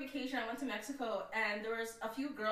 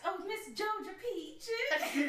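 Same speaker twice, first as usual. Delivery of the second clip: high, slow Southern drawl; hammed-up Southern belle, laughing; 6 semitones higher, much slower than usual. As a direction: gush like a Southern lady. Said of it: "those Southern belles".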